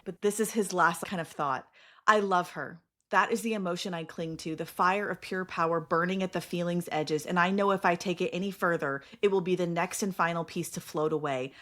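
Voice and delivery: steady, intense book reading; story narration read to friends; dramatic reading. Acted. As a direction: read the climax like an audiobook. Reads scene aloud.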